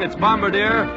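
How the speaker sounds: ordinary delivery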